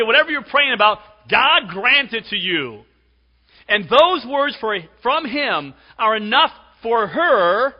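high, forceful pulpit delivery; full-force preaching. Real, not acted. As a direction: drive it home to the congregation loudly.

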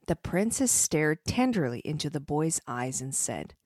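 plain reading voice

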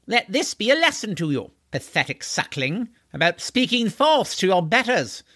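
high, haughty archaic scolding; pompous horseman, gloating. Acted. Horseman denying the boy a pillow, pompously gloating.

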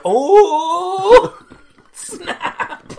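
high, then laughing breathy snap; mock surprise. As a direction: gasp it out. Reacting to killing curse.

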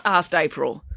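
ordinary delivery

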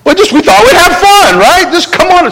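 high, sing-song excuse; breezy coaxing to join the fun; cheerful Martha pleading. Retold or imitated. Martha coaxes her family to relax, high and bright.

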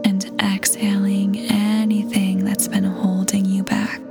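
usual speaking voice

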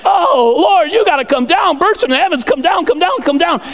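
high, urgent repeated pleading; frantic acted prayer. Percy praying for heaven to come down, frantic acted pleading. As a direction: beg heaven in mock desperation. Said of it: "The servant is praying".

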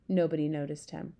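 ordinary delivery

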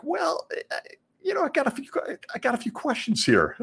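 high-pitched, hesitant mock questioner; questioning member. A puzzled Witness reacting to the announcement, high mock-questioning voice. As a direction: pipe up high as a doubtful churchgoer. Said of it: "you might think".

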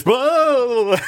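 slow, high, loud spooky "blah"; playful ghost voice; mock scare. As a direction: jump out with a hammed-up "blah". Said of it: "we need a plan".

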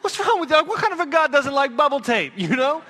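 put-on high, loud outraged little boy; indignant kid protest. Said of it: "I'm like".

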